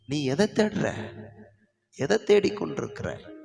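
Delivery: insistent questioning. Acted. Preacher voices the Lord to searching listeners, insistent.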